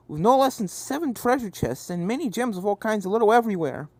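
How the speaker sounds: ordinary delivery, nothing marked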